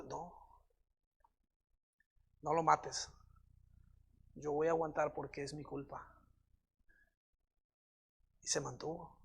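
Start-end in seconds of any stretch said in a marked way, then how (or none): 0.0-6.1 low, hushed resignation; quiet, subdued quote; resigned acceptance. Acted. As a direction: say it low and soft, accepting the blame. Acts out David's resigned reply.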